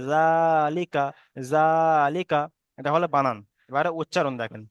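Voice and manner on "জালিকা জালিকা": slow recitation of জালিকা with a long held জা; lengthened first vowel. Shown as a demonstration. Teacher modelling the joined word for students, long জা. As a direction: stretch the "aa" of জা in জালিকা, keeping লিকা short. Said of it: "এক আলিফ পরিমাণ টান দিয়ে পড়তে হয়".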